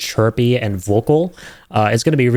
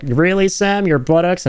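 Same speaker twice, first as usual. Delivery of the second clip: higher, indignant mock puppet voice; mocking doll man; 8 semitones higher than usual. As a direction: play the offended little doll. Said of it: "was like".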